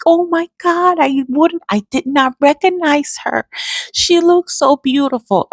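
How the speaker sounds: high, excited gushing about a student; gushing teacher. Retold or imitated. Teacher running up to the mother's car, high and gushing. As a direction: gasp it out, thrilled and amazed. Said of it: "she's just holding her face like".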